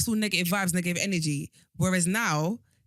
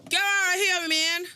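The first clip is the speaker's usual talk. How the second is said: much higher, a bit louder; high teasing shout; 12 semitones higher, 4 dB louder than usual. Real, not acted. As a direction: squeal it out laughing. Teasing outburst at the whisperer.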